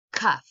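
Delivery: Cuff said as one punchy clapped beat; clapped syllable. Shown as a demonstration. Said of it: "Let's clap it out".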